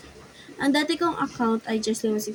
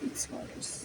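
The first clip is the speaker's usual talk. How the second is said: whispered Mixed colors; whispering; 14 dB quieter than usual. Shown as a demonstration. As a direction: breathe Mixed colors without voice. Repeating the phrase in different ways.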